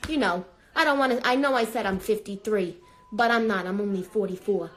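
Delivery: ordinary delivery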